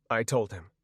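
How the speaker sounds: usual speaking voice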